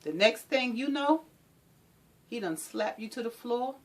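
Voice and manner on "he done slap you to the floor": usual speaking voice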